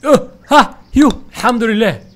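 very high, loud cry of relief; relieved exclaim. Real, not acted. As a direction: burst out in relief. Relief after a tense jump. (صيحة ارتياح عالية جدا)

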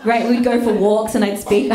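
ordinary talk

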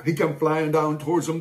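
plain reading voice

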